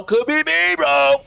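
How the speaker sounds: high, playful excited cry; hyped outburst. Pretend. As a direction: burst out high and loud, hyping yourself up. Hyped about possibly winning award.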